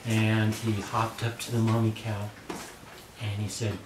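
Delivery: ordinary delivery, nothing marked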